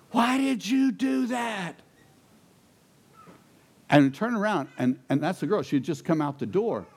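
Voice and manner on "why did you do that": high, slow, drawn-out cry from across the lot; retold scream. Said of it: "we hear this scream".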